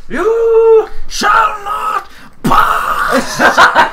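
booming wizard cry, stretched out; Gandalf shout. Pretend. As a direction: bellow it like Gandalf. Gandalf quote shouted in play.

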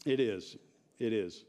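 ordinary talk, nothing marked

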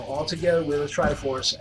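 normal talking voice

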